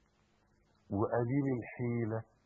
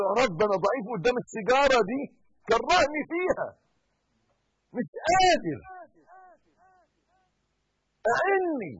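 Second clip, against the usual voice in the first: loud, high, rushed plea; pleading prayer; 14 semitones higher, 13 dB louder, faster than usual. Shown as a demonstration. Preacher modelling a smoker's prayer, loud and pleading. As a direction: beg God for help against the cigarette. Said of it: "قل له".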